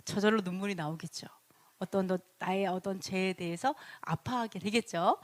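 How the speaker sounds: hushed, intimate whisper; 속삭이듯; soft whisper. Real, not acted. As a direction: lean in and whisper it. Intimate point about tears.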